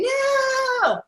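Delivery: long, high-pitched drawn-out wail; mock wail. Guest acting out people fighting change in their lives, mock wail. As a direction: cry out in exaggerated refusal.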